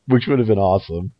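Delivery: normal talking voice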